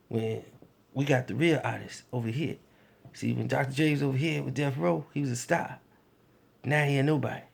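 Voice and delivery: low, soft, quick menacing talk; a label boss laying down the law quietly; Suge Knight voice. Retold or imitated. Suge Knight explaining his plan, softly and fast. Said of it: "get the voice down".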